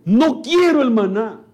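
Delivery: imitating a stubborn believer refusing; stubborn refusal. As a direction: dig in your heels, no no no. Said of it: "esa forma voluntaria de decir".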